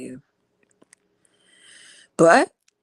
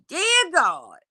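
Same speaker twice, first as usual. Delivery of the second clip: retold holler; 9 semitones higher than usual. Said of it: "I had to holler out".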